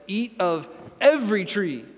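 EVERY punched loud and high; emphatic, generous command landing hard on "every"; stress on EVERY. Acted. Preacher quoting God's generous command to Eve, emphatic on "every". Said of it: "So God says".

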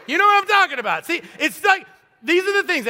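rally the congregation; excited preacher shout. Real, not acted. Preacher's emphatic punchline.

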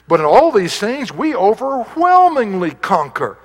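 loud and high, ringing triumph; triumphant preaching. Acted. Preacher to his church, shouting triumph. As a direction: proclaim the victory to the rafters. Preacher climaxes reading Romans eight.

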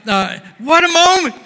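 high, loud, exultant preacher voice; full-force revival preaching; fiery preaching. Acted. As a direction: preach it with mounting joy. Excited preaching of the meeting.

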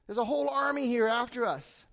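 acted panic, high and loud; freaking out. Servant who has found an army surrounding them, acted freaking out. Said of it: "He's freaking out".

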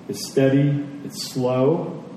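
normal talking voice

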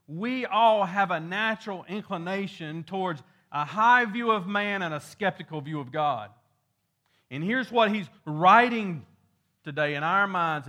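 fervent preacher lifting his voice on justice; preaching at full force. Acted. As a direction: drive the point home from the pulpit. Preacher presses the point.

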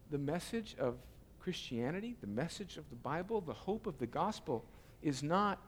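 soft, low, intimate preacher; hushed preaching. Acted. Pastor quietly telling friends this is the gospel, hushed. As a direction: drop to a near hush to draw them in. Sudden hushed sermon passage.

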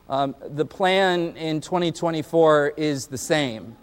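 usual speaking voice

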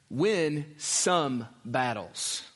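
some slowed and held; stress on some. Shown as a demonstration. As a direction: hold the word some a little longer. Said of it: "putting the word emphasis here on the word some".

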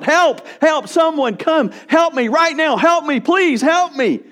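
high, loud, urgent cries; shouted plea for help. Retold or imitated. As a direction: call out across a store for help. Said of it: "he would shout".

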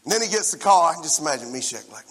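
usual speaking voice

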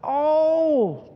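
high drawn-out wails, acted; high-pitched storybook cry of dismay; cried out in dismay. A narrator's reading. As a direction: wail as two distraught girls in a story. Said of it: "cried the girls".

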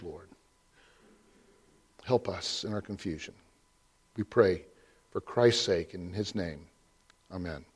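prayer. Acted. Pastor leads a prayer.

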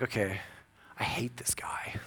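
whispered inner grudge; muttered seething. Retold or imitated. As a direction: hiss it privately, fuming.

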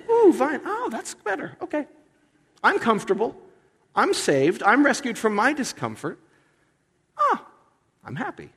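high, silly sighs of relief; mock contented Jonah. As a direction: coo over the vine like a pampered child. Mocking Jonah pleased with vine.

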